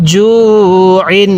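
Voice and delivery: drawn-out "জু", then clear "ইন"; one-alif stretch. Shown as a demonstration. Teacher showing how to learn it, "জু" held long. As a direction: hold "জু", close on a distinct n in "ইন". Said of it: "এরকমভাবে".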